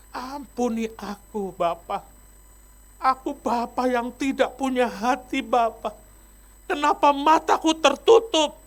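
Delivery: a broken father begging forgiveness; tearful prayer. Acted. Pastor face-down on the floor confessing to God, tearful. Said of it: "pendeta Yahya nangis".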